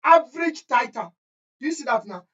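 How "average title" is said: high, formal examiner's phrasing; teacher mimicking the exam board's wording; quoting the examiner. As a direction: read it out like the marking scheme. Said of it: "waec will say".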